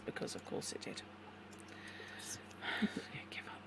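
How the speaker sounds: whispered exasperated aside; muttered under breath. Real, not acted. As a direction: grumble it to yourself.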